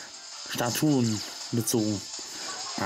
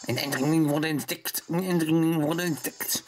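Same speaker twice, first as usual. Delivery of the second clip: alarm announcement; 4 semitones higher than usual. Pretend. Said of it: "einmal präsentiert, was passiert".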